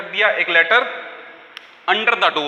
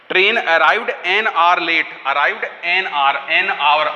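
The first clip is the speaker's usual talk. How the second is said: extremely slow dictation, "an" weighted in "an hour"; teacher slowly repeats "an hour" to drill the article; stress on an; much slower than usual. Shown as a demonstration. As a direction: stretch "arrived an hour" and hit "an". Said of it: "आर से पहले एन आएगा".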